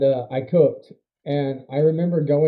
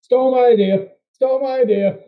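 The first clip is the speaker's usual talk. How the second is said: mock accusing; 8 semitones higher, 8 dB louder than usual. Real, not acted. Friend copies his pitch.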